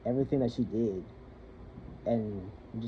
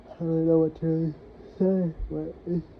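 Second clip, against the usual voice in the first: higher, strained and close to tears; choked up; 5 semitones higher than usual. Real, not acted. Speaker confiding about a painful relationship, choked up. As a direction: struggle to get the words out.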